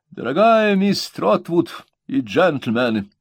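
slow, high, grand reading; pompous reading. A narrator's reading. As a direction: read it out with great self-importance. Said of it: "С хорошо мне знакомым напыщенным видом".

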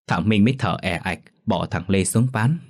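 ordinary delivery

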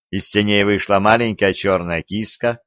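usual speaking voice

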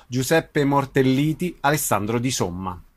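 normal talking voice

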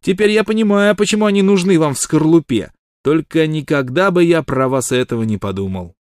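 high, loud mock outrage; hotly indignant. A narrator's reading. Shorty feigns offended virtue at Wild Water, hot indignation. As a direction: play wounded virtue to the hilt. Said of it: "горячо продолжал малыш с видом оскорблённой добродетели".

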